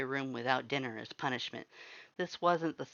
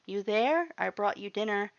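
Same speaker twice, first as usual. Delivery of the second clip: gentle coaxing; 7 semitones higher, 3 dB louder than usual. A narrator's reading. Calling to hiding Butch.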